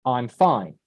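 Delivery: low, unconvinced delivery; words say fine, voice says otherwise; not fine. Shown as a demonstration. Teacher shows students feeling in intonation, low and not fine. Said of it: "my intonation, my feeling is different".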